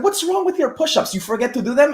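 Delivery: light mock coach teasing. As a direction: rib the athlete about the push-ups. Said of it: "He's like".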